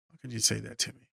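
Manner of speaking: softly retold disbelief; hurt, quiet quote. Brother hurt by what he heard on the phone, hushed.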